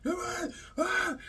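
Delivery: high, strained yell of a taunt; mock scream. Fan retelling Cody's taunt, yelling high. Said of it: "screaming at him".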